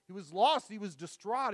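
fiery sermon peak, nearly shouting; preaching at full force. Acted. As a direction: preach it out at the top of your voice.

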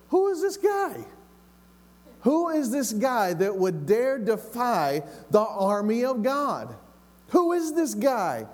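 high-pitched, incredulous challenge; high indignant questioning; indignant young David. Acted. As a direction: demand an answer from your brothers, pitched well up.

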